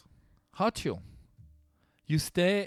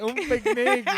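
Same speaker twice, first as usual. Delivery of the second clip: high mock Brazilian; echoed accent; 11 semitones higher, 8 dB louder than usual. Cohost echoing the Brazilian picnic line high. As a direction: repeat it in a high put-on accent.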